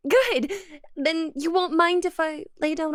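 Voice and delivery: a high loud bright "Good!"; delighted. Acted. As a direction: pounce on his answer happily. Pleased with his answer.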